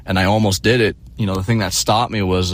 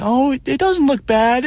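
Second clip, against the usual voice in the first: high mocking falsetto; sing-song mock reassurance; mocking mom. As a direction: ape mom's fake reassurance up high. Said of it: "kept saying".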